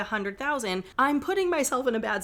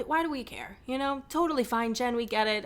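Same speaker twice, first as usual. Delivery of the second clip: ordinary talk, nothing marked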